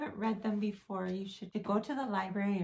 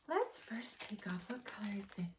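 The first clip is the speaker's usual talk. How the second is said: soft hushed question; whisper; 6 dB quieter than usual. Acted. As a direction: whisper it to the kids. Whispering to children.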